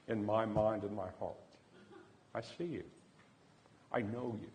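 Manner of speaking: hushed, heartfelt avowal; tender confession. Retold or imitated. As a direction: let each phrase land softly, with space between. Heartfelt confession to a stranger, retold.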